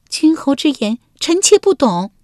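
high, coy court lady voice; lady Wenjiang. A narrator's reading. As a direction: answer sweetly as the young wife. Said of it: "文姜摇头说道". (文姜娇声作答)

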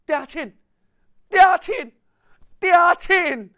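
calling father with rising eagerness; fond calling. A narrator's reading. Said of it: "叫到真够亲，真够甜".

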